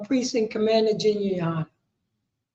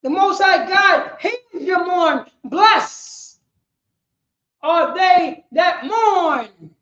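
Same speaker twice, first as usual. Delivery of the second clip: preaching slow shout; 5 semitones higher, 5 dB louder, much slower than usual. Real, not acted. Preacher climaxes slowly.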